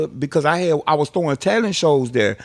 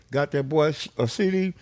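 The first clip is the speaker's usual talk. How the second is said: usual speaking voice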